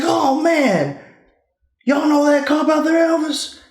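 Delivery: a high, lightly voiced cop, surprised; imitating the cop. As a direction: pitch up to play the cop who recognizes him.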